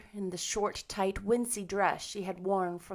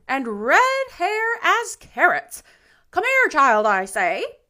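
high, loud, blunt inspection; outspoken busybody; 9 semitones higher, 10 dB louder than usual. A narrator's reading. Rachel inspects Anne aloud for Marilla, outspoken. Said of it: "speaking their mind without".

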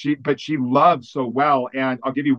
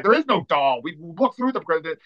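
imitated protest, pitched higher; mock objection in a raised pitch; retold protest; 4 semitones higher than usual. Kids objecting to Heidi about presents, protesting.

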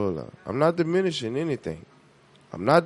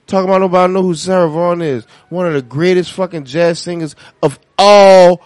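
indignant; 3 semitones higher, 9 dB louder than usual. Real, not acted. Indignant rant about [name].

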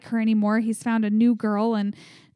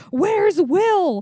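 mock urgent call, pitched up; retold urgent call; 7 semitones higher, 4 dB louder than usual. Co-host retelling Mike asking after his missing friend, raised urgent mock call. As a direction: call it out high, as if demanding an answer.

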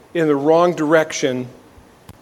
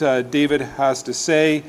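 usual speaking voice

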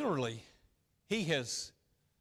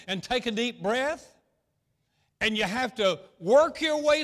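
preaching at full force; 6 semitones higher, 8 dB louder than usual. Real, not acted.

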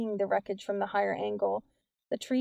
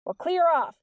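high, loud command across a distance; shouted order; 8 semitones higher, 6 dB louder than usual. A narrator's reading. Officer ordering kids off the hill, shouting. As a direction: shout them away. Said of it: "the officer shouted".

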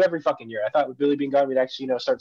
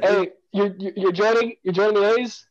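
mock surprise; 6 semitones higher, slower than usual. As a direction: play up the shock at a friend's switch. Friend switches team allegiance.

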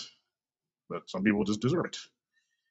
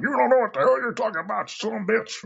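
mock angry retort; 12 semitones higher than usual. Vlogger mocking Trump fans, snapping angrily. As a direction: fire back at the MAGA crowd. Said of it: "I'm like".

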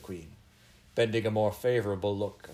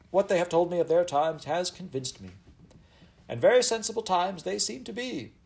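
high, loud royal proclamation; raised voice; 8 semitones higher, 6 dB louder than usual. A narrator's reading. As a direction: address a whole crowd as a commanding queen. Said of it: "She raised her voice and addressed the crowd".

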